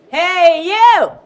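loud, high hey you; menacing men calling to a girl. Acted. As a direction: shout across the street at her. Street men call out to Amy.

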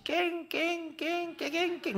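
high, mocking dog-yelp "깽". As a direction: mimic the taunting yelps of the locals. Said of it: "근데 이게 뭔 소리고". (놀리듯 높게 "깽" 흉내)

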